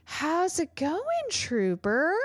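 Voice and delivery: high, drawn-out nervous greeting; strained, high-pitched mock cheeriness; nervous dentist. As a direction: imitate a nervous dentist faking cheer, stretching the first word. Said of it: "looking at me very nervously and was like".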